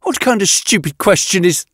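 high, exasperated protest. A narrator's reading. Yatena dismisses a colleague's suggested question, exasperated. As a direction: scoff in high-pitched exasperation. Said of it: "voice loud and exasperated".

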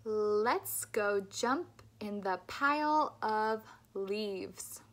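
every L in "leaves" said slowly, clearly; slow model sentence. Shown as a demonstration. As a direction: stretch each L out slowly. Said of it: "Stick your tongue out and say".

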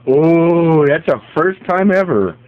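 high, loud startled outburst; startled exclamation. Real, not acted. Owner startled when the dog snaps, loud and high. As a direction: jump at the dog's snap.

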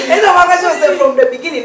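laughing as she repeats the point; laughing through it. Real, not acted. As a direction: repeat it while still cracking up. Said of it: "repeats it laughing".

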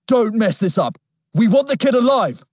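high, loud barked command; shouted order. A narrator's reading. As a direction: yell it at your partner. Thug shouting orders in chase.

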